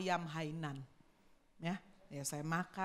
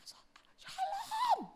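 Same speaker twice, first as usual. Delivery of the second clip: breathy voiceless whisper, much higher; preacher shows her voiceless greeting; hoarse whisper. Shown as a demonstration. Whispered shalom with no voice at all. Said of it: "saya tetap khotbah".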